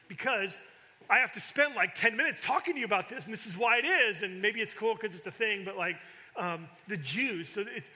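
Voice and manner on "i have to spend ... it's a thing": rising, louder, faster frustrated rant; venting real annoyance at the translators; worked-up rant. Real, not acted. Preacher venting at Bible translators, worked-up and louder. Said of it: "gets me angry because".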